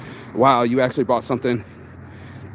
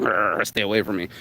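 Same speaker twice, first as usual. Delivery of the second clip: growling like a dog; 7 semitones lower than usual. Retold or imitated. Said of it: "like little dogs".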